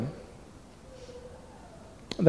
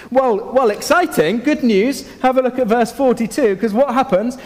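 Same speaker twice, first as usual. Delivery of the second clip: excited; 7 semitones higher, 3 dB louder than usual. Acted. Good news after famine story.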